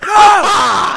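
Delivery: gasp, then loud high no; horrified shout. Real, not acted. Player losing the car mid-race, dismayed scream.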